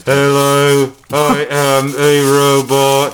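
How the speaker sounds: loud, buzzing monotone robot; slow robot speech through foil over the mouth; tinfoil robot voice. Shown as a demonstration. Demonstrating the foil trick to a friend, robot voice. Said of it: "you can get Robo Voice".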